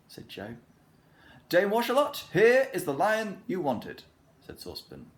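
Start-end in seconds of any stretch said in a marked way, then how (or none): 1.5-4.0 high, loud, proud presentation; beaming proudly. A narrator's reading. Saucepan presenting the lion to Dame Washalot, loud and beaming. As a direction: show off the gift with delight.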